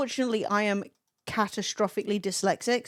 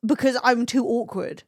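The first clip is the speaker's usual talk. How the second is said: normal talking voice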